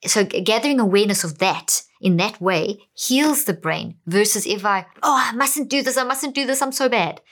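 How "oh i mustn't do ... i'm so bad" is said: higher, fretful voice scolding yourself; hammed-up panic at your own mistake; panicky self-scolding. Shown as a demonstration. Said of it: "Can you see the difference?".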